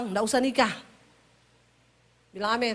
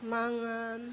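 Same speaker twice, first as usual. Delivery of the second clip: soft falling Javanese; 8 dB quieter than usual. Retold or imitated. Wife correcting herself to husband, soft falling. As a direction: drop to much softer, falling. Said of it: "nada belakangnya turun".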